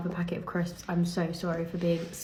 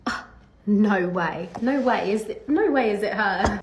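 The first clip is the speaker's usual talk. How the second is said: mock shock; 2 semitones higher, 4 dB louder than usual. Golfers learning she is the pro, mock shock. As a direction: act out astonished onlookers who can't believe it. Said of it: "the typical shock of when I said".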